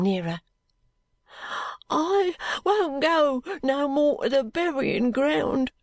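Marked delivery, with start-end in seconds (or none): 1.9-5.7 put-on high, drawn-out voice of a sick boy; slow, high whine of a poor street boy; muttering frightened boy. A narrator's reading. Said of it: "muttered the boy".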